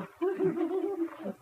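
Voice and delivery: high-pitched short laugh. Real, not acted. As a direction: break into a high little laugh. Laughs after quoting the quarrel. (cười giọng cao)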